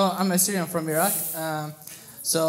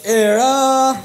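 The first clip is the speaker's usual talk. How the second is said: loud, higher, punched out; shouted demo word; 5 semitones higher, 11 dB louder than usual. Shown as a demonstration. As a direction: throw the word at the crowd.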